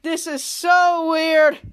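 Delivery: slow, loud, stretched disbelief; weirded out. Acted. Kid Danger watching the slap fight, slowly marvelling. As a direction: draw it out in mock amazement.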